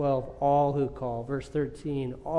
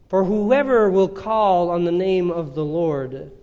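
fervent preaching; 4 semitones higher, 6 dB louder than usual. Acted. Preacher to congregation on grace that never runs out, full-force preaching.

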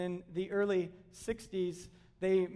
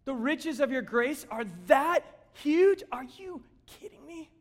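higher, slow, wide-eyed astonishment at grace; joyful disbelief; 6 semitones higher, 5 dB louder than usual. Pretend. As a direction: marvel out loud like a kid on Christmas morning. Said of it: "we're just gonna be like".

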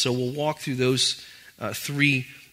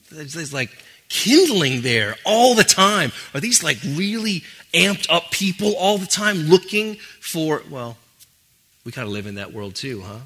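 ordinary talk, nothing marked

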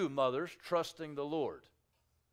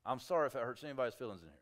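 quick aside; 4 semitones lower, 5 dB quieter, much faster than usual. Real, not acted. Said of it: "quick aside to congregation".

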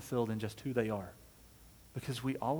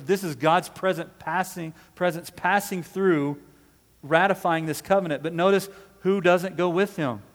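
loud, higher-pitched, fervent preaching; 6 semitones higher, 9 dB louder than usual. Real, not acted. Preacher explaining God's covenant with Abram to the congregation, fervent preaching. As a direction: proclaim it with force, stretching the weighty phrases.

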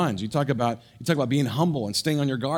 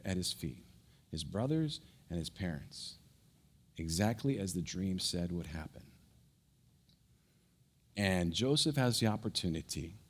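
hushed preaching; 5 semitones lower, 6 dB quieter than usual. Acted. Pastor quietly telling of the brothers kneeling, hushed. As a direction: drop to a near hush to draw them in.

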